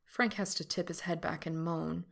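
ordinary delivery, nothing marked

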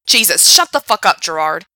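loud angry snap. Acted. Frank snapping at Gerard to stop, loud angry snap. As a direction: cut him off hard. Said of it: "Frank snapped".

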